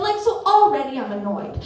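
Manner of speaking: normal talking voice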